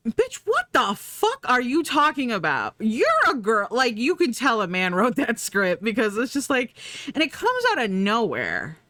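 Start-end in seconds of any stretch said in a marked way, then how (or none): 0.1-3.7 indignant retort. Retold or imitated. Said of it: "And it's like".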